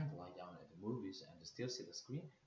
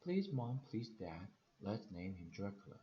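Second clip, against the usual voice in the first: ordinary delivery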